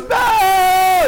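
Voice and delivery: very high, loud shout; mom shout. Retold or imitated. Mom imitated shouting for Belle, very high and loud. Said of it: "And mommy goes".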